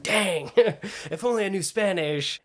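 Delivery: laughing, high and slow mock regret; joking lament. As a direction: drag it out high through a laugh. Jokes about lacking Spanish.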